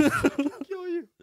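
high strained mock rage; berserk rage.

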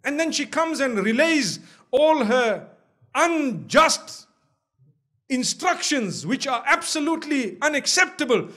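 ordinary delivery

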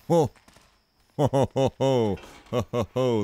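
loud, staccato ho-ho exclamations; loud, knowing "ho ho ho" bursts; exaggerated "ho ho ho". Real, not acted. Player reacting to the plot, loud exaggerated ho-hos.

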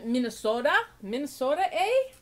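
playful mock Minnesota accent. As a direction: put on a Minnesota accent, rising on the eh. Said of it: "not trying to make fun".

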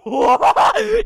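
high, loud whoop of joy; overjoyed high-pitched cackle; elated screaming laugh. Real, not acted. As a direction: burst out in a shrieking laugh. Celebrates news in a donation message.

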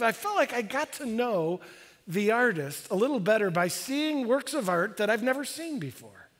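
usual speaking voice

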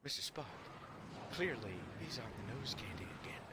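hushed, rushed conspiratorial whisper. Acted. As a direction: lean in and whisper the gossip to Spock. Conspiratorial aside to Spock.

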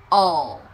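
"Ồ" drawn out, ending on a dark L; English "all". Shown as a demonstration. Teacher shows the tongue position, "Ồ" ending in L. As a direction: curl the tongue tip up to close "Ồ" with L. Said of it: "Đó, nhìn nhá".

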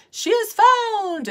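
high, loud, drawn-out cry; shouted. A narrator's reading. Wisest counselor announcing the bride is found, shouted. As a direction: proclaim the discovery, slow and ringing. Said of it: "shouted the wisest of the counselors".

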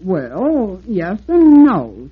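pompous little engine; important. Acted. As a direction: puff yourself up. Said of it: "said Herman importantly".

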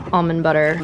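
usual speaking voice

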